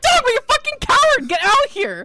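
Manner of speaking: furious high shout through the door; angry yelling. Acted. Codex pounding the shop door, yelling at family. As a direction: scream at the coward inside. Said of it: "pounds the door".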